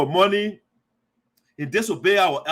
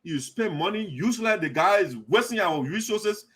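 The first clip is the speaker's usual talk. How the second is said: usual speaking voice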